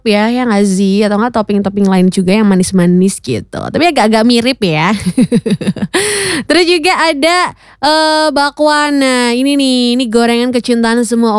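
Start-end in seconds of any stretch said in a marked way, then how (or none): 5.0-6.5 light rippling laugh; short run of giggles; chuckle. Real, not acted. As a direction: chuckle along at your own remark. Amused radio chat.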